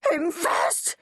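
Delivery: storyteller's squealed protest; shriek. A narrator's reading. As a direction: shriek it in a panicky high voice. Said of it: "shrieked Henry".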